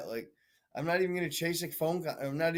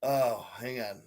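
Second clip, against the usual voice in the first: ordinary talk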